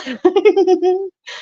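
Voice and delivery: high real laugh; burst of laughter. Real, not acted. Laughs at 911 comment.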